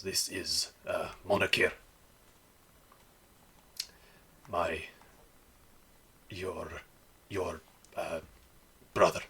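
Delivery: halting, with long pauses; stumbling over words. Acted. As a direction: struggle awkwardly to get an introduction out. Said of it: "stumbling over his words a little bit".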